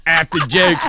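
high-pitched fan in a Pittsburgh accent. Shown as a demonstration. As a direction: gush as a Pittsburgh local, accent laid on thick. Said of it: "representation of it".

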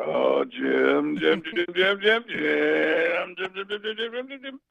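rising, engine-like Jim Jim Jim; Tony Romo revving. Retold or imitated. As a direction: rev it up like a car climbing through the gears. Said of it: "like revving up a car".

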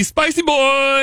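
put-on sing-song teasing over spicy boys; teasing drawl. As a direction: stretch out spicy like a playful taunt. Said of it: "a little shoulder work that he has when he says spicy boy".

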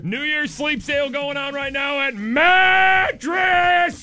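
loud, slow announcer shout; shouted ad plug. Acted. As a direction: bellow the brand like a promo.

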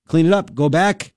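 usual speaking voice

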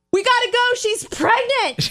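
high-pitched, mock freaked-out yell; panicked yell. Husband blurting out at the baby shower, mock panicked yell. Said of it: "husband freaked out and yelled".

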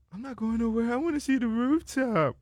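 high, squeaky imitation of a strung-out woman; mock crackhead woman. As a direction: pitch it way up like the spaced-out girl. Crackhead woman quoted in story.

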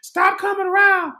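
high-pitched imitation of a woman yelling; retold, exasperated high yell; acted-out yelling. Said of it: "and she's yelling".

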